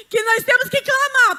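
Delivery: high, loud cry to the church; loud exhortation. Real, not acted. Speaker urges church to pray, loud and high-pitched. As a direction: call it out while still worked up. Startled announcer resumes her exhortation.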